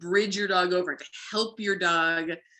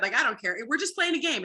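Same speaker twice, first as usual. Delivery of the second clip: usual speaking voice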